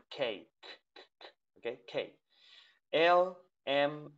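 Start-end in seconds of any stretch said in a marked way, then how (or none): 0.1-1.3 "K" as kay with a puff, then bare "k" puffs; aspirated K. Shown as a demonstration. Teacher shows the aspiration to students, breathy-released "k". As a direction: release each "k" with air and no voice. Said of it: "porque tiene una aspiración".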